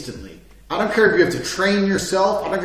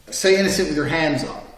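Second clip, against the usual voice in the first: usual speaking voice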